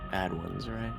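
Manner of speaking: normal talking voice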